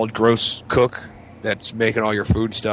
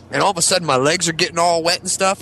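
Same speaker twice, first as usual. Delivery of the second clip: normal talking voice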